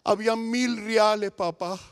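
slow, halting daughter in tears; acted weepy report; imitated tearful telling. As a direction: tell papá slowly, as if crying. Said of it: "se puso a llorar".

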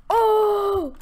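high, very loud "oh"; triumphant shout. Real, not acted. Gamer just landed a shot, triumphant high shout. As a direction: burst out in triumph. Celebrates hitting the opponent.

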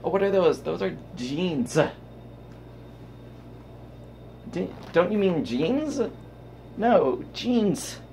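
mock quarrel about saying jeans; mock dialogue. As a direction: act out two people arguing over jeans. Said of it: "we pronounce it like a Z".

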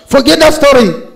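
high, full-voiced preacher's shout; shouted command. Real, not acted. Preacher orders congregation to forget that story, forceful shout.

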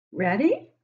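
ordinary delivery, nothing marked

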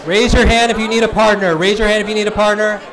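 loud, high call over a crowd. Real, not acted. Speaker finding partners for a noisy audience, calling out. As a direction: shout it so the whole room hears.

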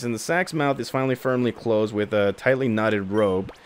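ordinary delivery

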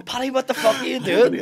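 high, loud heckle; imitated crowd shouting. Baffled crowd shouting at Paddy on stage, loud heckle. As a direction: shout up at the act in disbelief. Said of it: "people were shouting".

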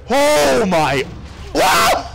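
loud, high, climbing to a screech; real startled shriek; shocked outcry. Real, not acted. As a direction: cry out in sudden alarm. Hit hard in game.

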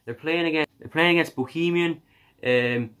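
hammed-up Irish accent. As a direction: keep the jokey Irish accent going. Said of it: "I'm gonna stop doing the accent now".

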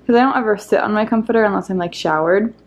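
ordinary delivery

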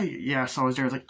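usual speaking voice

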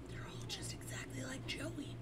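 hushed whisper. Real, not acted. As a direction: whisper it as a confession. Whispered aside.

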